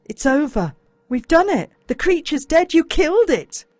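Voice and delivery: loud, high, pleading relief; boy urging his dazed best mate; urgent relief. A narrator's reading. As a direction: shake him awake with the news. Urging dying friend, relieved.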